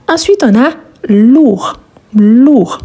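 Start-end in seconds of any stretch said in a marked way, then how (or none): none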